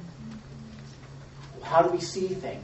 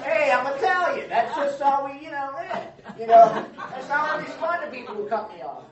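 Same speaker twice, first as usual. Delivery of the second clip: mock tough Italian; 6 semitones higher, 5 dB louder than usual. Mocking an excuse for rudeness.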